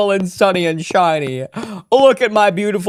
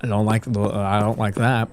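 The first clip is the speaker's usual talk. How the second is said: much lower, soft uneasy grumble; low uneasy mutter; 14 semitones lower, 8 dB quieter than usual. Real, not acted. Uneasy about the strange house.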